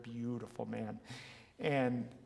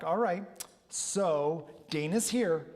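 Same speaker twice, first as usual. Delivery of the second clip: higher, louder cheerful handoff; excited announcing; 6 semitones higher, 7 dB louder than usual. Retold or imitated. Coach ending the call so Dana takes over, excited. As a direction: announce it with playful glee.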